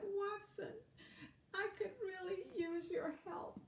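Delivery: high, soft ladybug voice, a touch of tears; mild sad pleading. Acted. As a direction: plead gently, close to crying.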